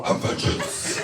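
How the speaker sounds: whispered low Batman growl; granny Batman rasp. Retold or imitated. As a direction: answer like Batman, voice gone to a hush. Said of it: "She'd go".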